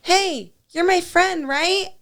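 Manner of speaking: put-on high, eager child voice; a small boy seeking his friend's yes; hopeful little boy. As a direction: son asking the bully, high and eager. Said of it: "he goes".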